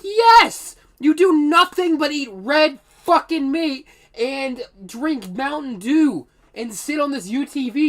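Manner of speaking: loud, high, slow exasperated shout; exasperated yell. Retold or imitated. Worker yelling at janitor about his diet, exasperated shout. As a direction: blast it at him in disbelief.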